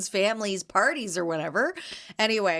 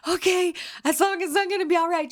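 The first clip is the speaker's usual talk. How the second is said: high relieved voice; 11 semitones higher than usual. Retold or imitated. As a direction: sound like a relieved child. Relieved reply, retold.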